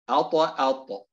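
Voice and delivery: أط، أط with a strong echo on the t; أط، أط with a full qalqala release; stronger qalqala. Shown as a demonstration. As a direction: bounce the final ط of أط hard, twice. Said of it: "التمييز بين مراتب القلقلة".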